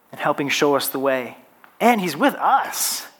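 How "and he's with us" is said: slow phrase landing on "us"; stress on us. Shown as a demonstration. Preacher moving to the title's last word, stress on "us". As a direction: draw it out and lift "us" as the point. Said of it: "emphasis on each of the different words".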